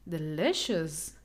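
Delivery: "delicious" drawn out slowly with a low pitch; low, measured de-LISH-us for "delicious". Shown as a demonstration. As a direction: repeat "delicious" slowly and softly for learners, middle LI held. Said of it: "The stress is here".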